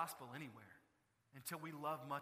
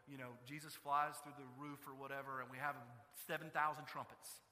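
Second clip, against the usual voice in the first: usual speaking voice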